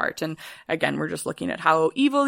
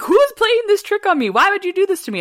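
high, loud hammed-up panic; mock-terrified Macbeth; 13 semitones higher, 6 dB louder, faster than usual. Retelling Macbeth seeing the ghost, hammed-up high panic. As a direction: squeal it like a spooked king. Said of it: "he's like".

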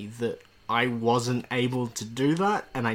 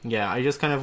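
ordinary talk

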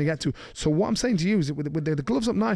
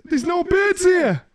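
much higher, loud, slow bellow; prisoner shout; 7 dB louder, slower than usual. Retold or imitated. Prisoner yelling at the kids, drawn-out shout. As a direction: roar it across the room to scare them.

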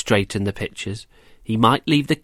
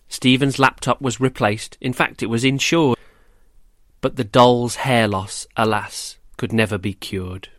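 usual speaking voice

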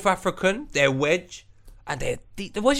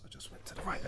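hushed aside; 18 dB quieter, faster than usual. Retold or imitated. Slipping away in the shadow.

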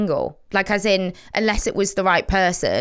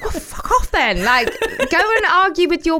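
laughing retort; 10 semitones higher, 3 dB louder than usual. Retold or imitated. Friend retorting to smug coupled friends, laughing. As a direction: brush them off with a laugh.

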